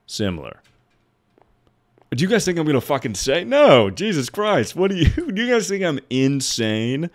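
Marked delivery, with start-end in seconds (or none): 2.1-7.1 high-pitched, exasperated disbelief; incredulous protest. Real, not acted. Streamer to chat expecting a slur, incredulous high protest. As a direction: protest in high, half-laughing outrage. Mock outrage at chat's suspicion.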